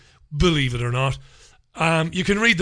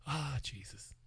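breathy whisper under the breath; whispered aside; 2 semitones lower, 19 dB quieter than usual. Real, not acted. Host muttering to himself over a silly news story, whispered. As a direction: mutter it to yourself, half amused.

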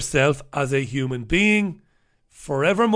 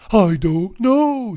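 slow, drawn-out vacant I don't know; slow clueless health worker; mock clueless caller; 3 semitones higher, 3 dB louder, much slower than usual. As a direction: stretch it out blankly, like a caller with no answers. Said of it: "she said to John".

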